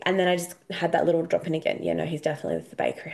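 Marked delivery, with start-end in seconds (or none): 1.8-3.1 much lower, softer and fast, no feeling in it; matter-of-fact inner voice, low and quick; monotone inner voice. Retold or imitated. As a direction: rattle it off low like a flat inner voice. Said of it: "when I say a monotone voice".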